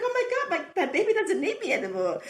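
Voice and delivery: mock tearful wail. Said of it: "oh, I started crying".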